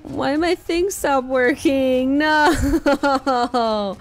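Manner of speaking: loud, slow cry of dismay; dismayed wail. Real, not acted. Streamer whose thing stopped working, loud dismay. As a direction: wail it out, drawn out.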